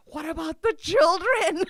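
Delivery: high, loud, slow mock alarm; mock horror. Worrying aloud about the neighbourhood kids, loud mock horror. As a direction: clutch your pearls, loudly.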